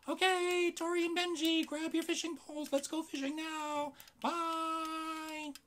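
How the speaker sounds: loud high squeaky puppet call; squeaky dummy shouting. Acted. Puppet calls kids to go fishing.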